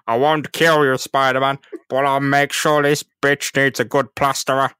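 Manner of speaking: loud gloating supervillain; villain voice. Retold or imitated. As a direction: boom out the villain's threat. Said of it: "As, uh, Doctor Octopus is planning".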